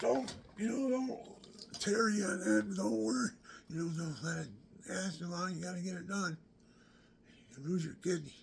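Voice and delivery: a high, loud mock nurse pleading with him; urging nurse. Nurse urging the patient not to wait, high, loud and pleading.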